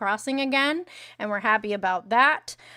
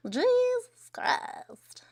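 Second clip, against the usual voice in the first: breathless whisper, drawn out very slowly; 13 semitones higher, 3 dB quieter, much slower than usual. Real, not acted. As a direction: let the shock out in a slow whisper.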